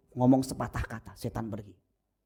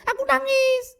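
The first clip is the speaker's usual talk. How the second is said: put-on very high childish whine; mock small child claiming to cry; whiny child; 7 dB louder than usual. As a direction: pout it like a little kid. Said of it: "Padahal nggak nangis".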